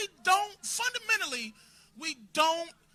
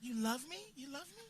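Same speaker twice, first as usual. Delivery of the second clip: mock needy murmur; 2 semitones lower, 12 dB quieter than usual. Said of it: "force us to have an emotion".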